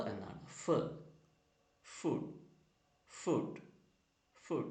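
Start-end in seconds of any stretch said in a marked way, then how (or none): 0.6-0.7 "f" as "fuh"; letter sound f. Shown as a demonstration. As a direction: say "f" as its sound, not "ef". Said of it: "letter pronounce ചെയ്യുന്നത്".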